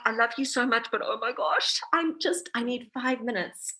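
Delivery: loving but at the end of her rope; exasperated mom. Retold or imitated. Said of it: "I'm just like".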